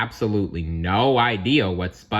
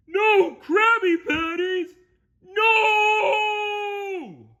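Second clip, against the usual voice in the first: very high, drawn-out cartoon wail; 6 dB louder, much slower than usual. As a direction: wail in despair, stretching the last word. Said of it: "he wailed".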